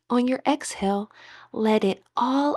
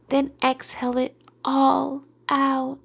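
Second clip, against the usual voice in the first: ordinary talk, nothing marked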